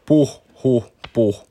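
lightly acted out-of-breath puffs; mock puffing. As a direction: puff the words like a tired little cyclist. Jyri pedals slowly, panting. (kevyesti puuskuttaen)

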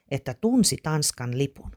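usual speaking voice

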